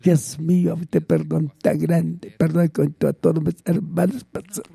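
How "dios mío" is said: ordinary delivery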